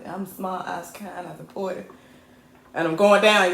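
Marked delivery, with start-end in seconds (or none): 0.1-1.9 softly recited self-reassurance; muttered affirmations. Real, not acted. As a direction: drop the volume and list them to yourself.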